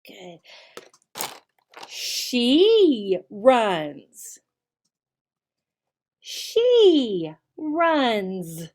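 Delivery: put-on each word said slow and clear for young learners; slow teacher drill voice. Shown as a demonstration. Teacher drilling a child on he runs, slow and clear. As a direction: model the sentence like a kids' English teacher.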